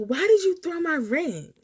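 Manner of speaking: high, loud upset question; upset demand. Retold or imitated. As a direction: confront her, stung and upset. Girl threw the ring.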